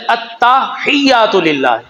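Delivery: التحیات للہ drawn out slowly with doubled t; correct Arabic recitation. Shown as a demonstration. Teaching the congregation the right Arabic, slow and precise. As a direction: spell out التحیات للہ slowly, stressing the tta. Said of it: "لفظ ہے".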